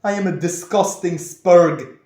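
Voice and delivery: retold shock at full volume; shocked outburst. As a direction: blurt it out in appalled disbelief, stretching the first words. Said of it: "I was shocked".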